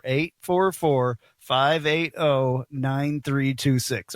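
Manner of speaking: slow, deliberate phone number; unhurried, digit-by-digit read. Real, not acted. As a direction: space out each digit for listeners. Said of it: "I'll say it slower".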